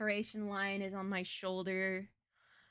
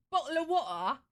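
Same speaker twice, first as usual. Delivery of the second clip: high, loud mock British accent; 6 semitones higher, 5 dB louder than usual. Streamer parodying the British for viewers, in a mock British accent. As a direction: caricature British, punched up high. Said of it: "American's idea of a British person".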